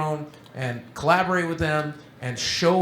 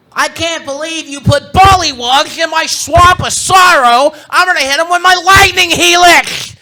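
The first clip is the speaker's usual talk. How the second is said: Francis rant shout; 12 semitones higher, 14 dB louder than usual. Acted. Ranting to himself while cleaning up the players' mess, raging shout. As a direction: explode like an outraged gamer.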